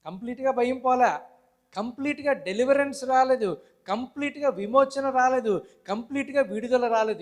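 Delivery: high, loud, insistent sermon delivery; forceful preaching. Real, not acted. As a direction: hammer each parallel phrase home to the congregation. Preacher drives point home.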